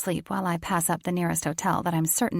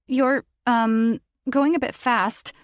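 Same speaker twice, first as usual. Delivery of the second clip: higher, louder anxious voice; nervous; 6 semitones higher, 5 dB louder, slower than usual. Acted. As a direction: nervously point out speed. Nervous at high speed.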